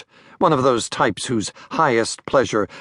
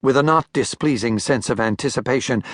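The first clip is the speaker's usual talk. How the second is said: ordinary delivery, nothing marked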